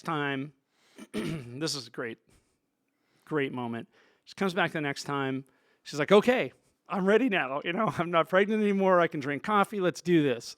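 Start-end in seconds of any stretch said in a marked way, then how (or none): 6.1-10.6 raised, louder mock-eager announcement; retold eagerness. Pregnancy over, ready for coffee, eager. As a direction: act out someone keen to get started.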